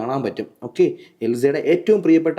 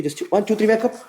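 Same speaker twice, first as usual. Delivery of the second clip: hypnotist's high-pitched wake-up count; wake-up command; 8 semitones higher, much slower than usual. Real, not acted. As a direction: count rising to a high call. Actual wake-up command to subject.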